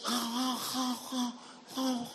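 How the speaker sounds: high, soft voiceless straining; lost voice. Retold or imitated. Pastor imitating the voiceless agent on the phone, straining softly. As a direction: imitate someone struggling to speak with no voice. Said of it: "has lost her voice".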